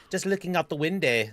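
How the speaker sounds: put-on reading Moira's line in a light Scottish accent; a tentative Scottish lilt for the girl; lightly Scottish, unsure of the accent. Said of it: "I want to pre-apologize if I screw up Scottish accents".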